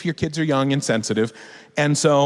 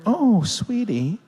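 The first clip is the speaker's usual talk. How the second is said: higher, slow, soothing coo; tender parent; 7 semitones higher, much slower than usual. Acted. Parent comforting a crying child, tender. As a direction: lean in gently, sharing a secret. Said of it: "And I'm like".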